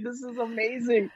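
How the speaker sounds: high squealing excitement; excited squeal. Retold or imitated. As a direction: squeal it out delighted. Retold excited reaction, high far.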